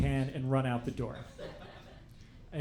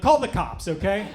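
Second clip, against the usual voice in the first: mock indignant yell; 5 semitones higher, 8 dB louder than usual. Demanding the manager call police.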